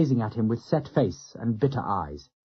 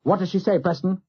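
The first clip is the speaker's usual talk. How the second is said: anxious question; 6 semitones higher than usual. A narrator's reading. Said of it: "Turner asked anxiously".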